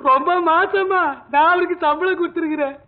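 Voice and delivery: put-on tearful drunken lament; drunken wailing. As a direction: wail it out like a mourner. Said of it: "மறுபடியும் ஏன் ஒப்பாரி வைக்கிற?". (ஒப்பாரி வைக்கும் அழுகைக் குரல்)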